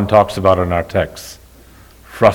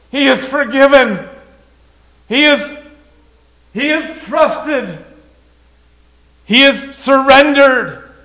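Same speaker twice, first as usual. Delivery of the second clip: high, loud, proclaiming, slowing on key words; jubilant father; 14 semitones higher, 4 dB louder than usual. Acted. Father declaring to the older son that his brother is forgiven: high, loud, jubilant. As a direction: proclaim that the lost son is alive.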